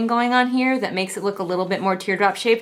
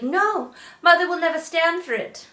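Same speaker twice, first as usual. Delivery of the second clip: high whiny mock voice; mocking the heroine; 8 semitones higher than usual. Reviewer mocking the heroine's protest, high and whiny. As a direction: sneer it like a spoiled girl. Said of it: "she's like".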